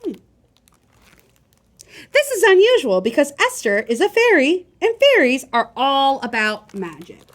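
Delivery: ordinary delivery, nothing marked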